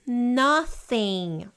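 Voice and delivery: ordinary delivery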